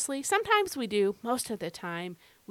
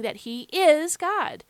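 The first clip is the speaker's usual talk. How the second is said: raised, louder and slow, with "is" punched; emphasis on IS; 6 semitones higher, 5 dB louder, slower than usual. Shown as a demonstration. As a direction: drive home the word "is". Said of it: "why am I emphasizing this present tense verb".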